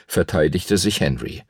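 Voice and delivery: ordinary delivery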